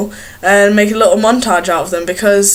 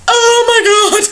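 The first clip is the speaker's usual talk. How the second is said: high, shrieking mock alarm; mock panic; 12 semitones higher than usual. Vlogger imagining poking her own eye in. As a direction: act shocked as the eye goes in. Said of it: "envisioned myself going".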